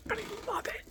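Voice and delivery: whispered confession. Real, not acted. Whispered confession about the bag.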